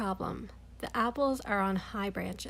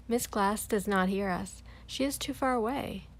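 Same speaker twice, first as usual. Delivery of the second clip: ordinary delivery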